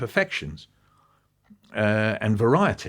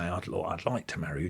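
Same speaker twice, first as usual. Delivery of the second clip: a hint of a bashful suitor, softer; hesitant shy proposal; 3 semitones lower, 9 dB quieter than usual. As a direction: mumble the proposal shyly, as Barkis would. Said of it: "So he just wants to say".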